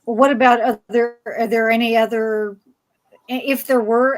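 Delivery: usual speaking voice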